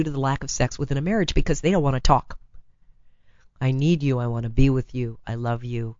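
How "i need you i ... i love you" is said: low-pitched, understated pleading quote; mock declaration. Spouse declaring love instead of talking, low and understated. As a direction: recite a lover's plea in a low voice. Voices what husbands won't say.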